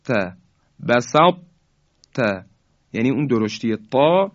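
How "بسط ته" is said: بسط ته with the ط kept heavy before ته; careful recitation. Shown as a demonstration. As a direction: let the emphatic ط still colour the merge into ته. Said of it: "به این شکل باید بگیم".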